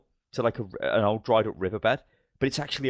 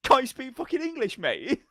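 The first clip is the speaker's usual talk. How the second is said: high-pitched indignant imitation; mock exasperation. British lad answering a baffled American, mock exasperation. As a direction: snap back in comic, pitched-up disbelief.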